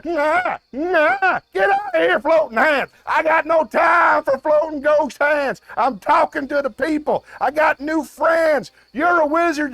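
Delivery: high, frantic mock-angry yelling; panicked shouting. Host orders the ghost hand out, panicked shouting. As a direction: chase it away, voice climbing high.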